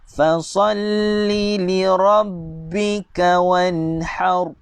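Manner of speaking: slow, drawn chant; measured tajwid recitation; Quran recitation. Shown as a demonstration. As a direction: recite it slowly, stretching each vowel.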